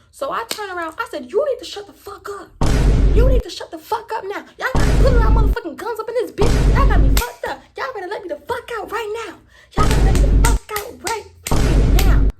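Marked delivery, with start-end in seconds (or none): 1.3-12.3 high, loud furious tirade; acted-out enraged shouting; retold yelling. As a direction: yell back and demand to be let out. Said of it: "I'm yelling at him".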